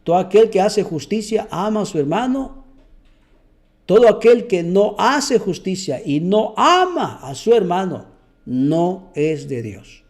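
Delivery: normal talking voice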